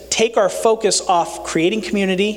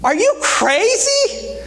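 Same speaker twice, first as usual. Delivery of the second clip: incredulous; slower than usual. Acted. The world questions Paul.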